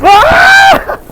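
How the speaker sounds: loud high yell in the air; excited yell. Real, not acted. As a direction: shout out mid-jump. Mid jump on kite.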